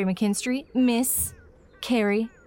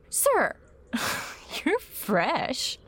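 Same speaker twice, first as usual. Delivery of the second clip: coy scolding; 4 semitones higher, much slower than usual. Acted.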